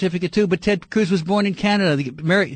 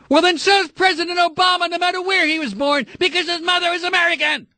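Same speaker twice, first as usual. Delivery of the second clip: very high, sustained, straining shout; exasperated yelling; 11 semitones higher than usual. Real, not acted. Host arguing Obama's birth like Cruz's, exasperated yelling. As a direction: yell the whole argument on one high pitch. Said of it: "Don't make me lose my voice".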